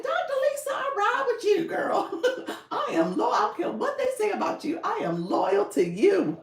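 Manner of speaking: high, loud, hammed-up declaration of devotion; friend's loyalty pledge. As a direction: imitate a friend gushing her support, laughing through it. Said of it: "She's like".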